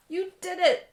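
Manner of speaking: high, loud proud exclamation; proud cheer. Acted. Dad celebrating his son's flying machine, loud proud cheer. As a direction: cheer him on.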